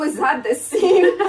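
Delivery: ordinary talk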